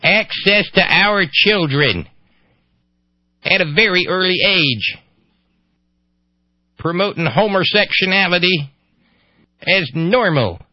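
high, loud mock-alarmed Ohio voice; ignorant Ohio voice. Host delivers the great quote, alarmed ignorant Ohio voice. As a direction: stretch it out loudly, ignorant Ohio accent. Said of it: "my ignorant Ohio voice".

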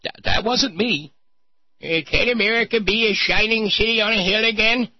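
high put-on mocking voice; mocking high silly voice. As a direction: lampoon him in a high voice. Mocking voice of Dobson and rabbi.

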